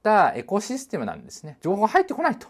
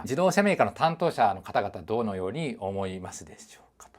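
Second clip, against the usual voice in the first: soft unvoiced whisper; 5 semitones lower, 5 dB quieter than usual. Real, not acted. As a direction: lean in and whisper the question. Whispered aside to car makers.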